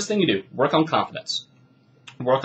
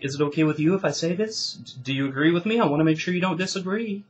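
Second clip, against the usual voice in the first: unsure uptalk; 4 semitones higher than usual. Retold or imitated. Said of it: "it's almost like".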